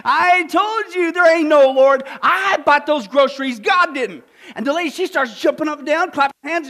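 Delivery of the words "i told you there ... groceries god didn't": gloating. Retold or imitated. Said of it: "he jumps out in the bush and he goes".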